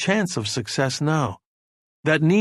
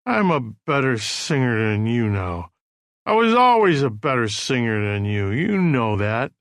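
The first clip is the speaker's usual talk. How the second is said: put-on drunken slurring. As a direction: slur the boast, weaving drunk. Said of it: "his voice slurring".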